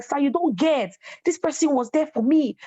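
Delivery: high, insistent pleading; pleading defense. Retold or imitated. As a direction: defend him hard. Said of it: "I'm like".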